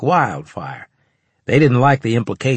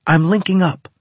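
high, louder, thrilled announcement; excited declaration; 9 semitones higher, 5 dB louder than usual. A narrator's reading. Natasha announces her link to her squadmates, excitedly declaring. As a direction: declare it with shining-eyed excitement. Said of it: "she declared, eyes shining".

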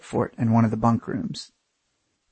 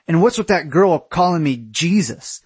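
high, indignant question; aggrieved complaint; 6 semitones higher, 3 dB louder than usual. A narrator's reading. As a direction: demand an explanation, offended. Aaron complains in quarrel.

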